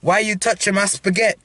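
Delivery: put-on Italian accent. Younger brother repeating the line in the challenge, mock Italian accent. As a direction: copy the complaint in mock Italian. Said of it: "Italian people do".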